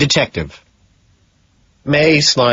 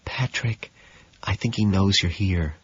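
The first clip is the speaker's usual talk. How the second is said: much softer, hushed warning; whispered; 8 dB quieter than usual. A narrator's reading. Jean whispering a warning to Patrick. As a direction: hush it so the visitor won't hear. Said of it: "then whispers".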